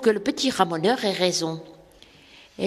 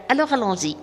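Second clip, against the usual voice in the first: plain reading voice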